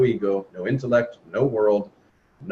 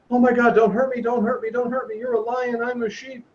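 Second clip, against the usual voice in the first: frightened little lion; 9 semitones higher than usual. Retold or imitated. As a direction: plead high like a scared cub. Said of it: "the little lion is like".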